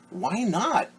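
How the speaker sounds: high questioning little pig voice; eager little pig. Acted. Pig asking the principal why, high and eager. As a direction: pitch it up like a small hopeful pig. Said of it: "asked Rufus".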